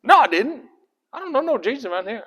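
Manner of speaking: high, fast country-Galilean protest; Galilean accent. Acted. Peter denying he knows Jesus, in a high-pitched Galilean accent. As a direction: blurt a denial in a comic Galilean accent. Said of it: "you've got a Galilean accent".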